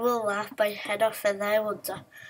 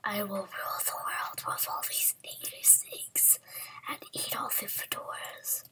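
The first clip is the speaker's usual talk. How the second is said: whispered villain boasts; megalomaniac rant; 11 dB quieter than usual. Pretend. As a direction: hiss out evil plans.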